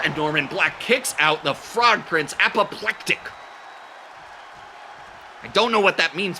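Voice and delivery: normal talking voice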